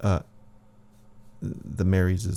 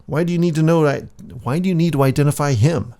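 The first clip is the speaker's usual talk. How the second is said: loud, higher, pressing questions; suspicious demand; 6 semitones higher, 6 dB louder, faster than usual. Acted. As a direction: grill them like a suspicious priest. Jaguar Priest suspicious, demanding.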